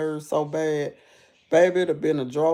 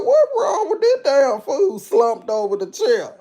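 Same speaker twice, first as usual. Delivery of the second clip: laughing; 8 semitones higher, 5 dB louder than usual. Retold or imitated.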